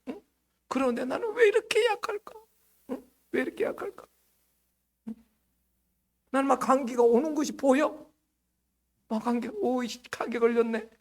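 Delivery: high, mock-tearful whining about always being sick; hammed-up self-pity, voice cracking; whining complainer. Whiny complainer acted.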